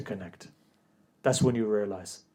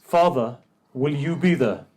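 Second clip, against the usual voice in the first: a louder, raised cry to her father; daughter's urgent question; 3 semitones higher, 8 dB louder than usual. Acted. Daughter asking her father who will be there, urgent and raised.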